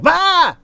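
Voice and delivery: high, loud startled cry; startled yell. Acted. Guards startled by a stranger in the dark, loud yelp. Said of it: "They jump and their weapons fall".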